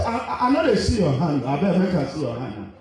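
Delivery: high, loud playful Nigerian Pidgin imitation. Preacher teasing the congregation as a Nigerian man, mock Nigerian Pidgin accent. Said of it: "Nigerian man say".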